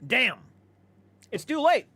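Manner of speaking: ordinary delivery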